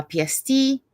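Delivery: ordinary talk, nothing marked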